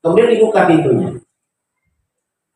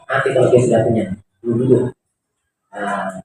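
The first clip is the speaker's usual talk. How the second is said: usual speaking voice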